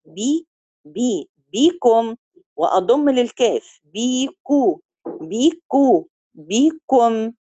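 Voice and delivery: بي بي then بيكو slowly, lips rounding on the كاف before closing on ميم; syllable-by-syllable demo. Shown as a demonstration. Teacher breaking the word down for students, slow. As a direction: build بيكم up piece by piece, slow and careful. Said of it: "يعني أنا دلوقتي ببدأ بي".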